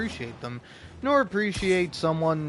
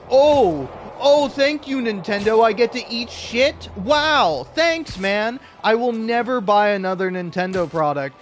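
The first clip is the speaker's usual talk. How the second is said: loud, high, sarcastic fury; furious rant; 7 semitones higher, 6 dB louder than usual. Retold or imitated. Gamer raging at Nintendo over Hades two, loud and high. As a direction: hurl it at Nintendo like a betrayed fan. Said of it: "and it's like".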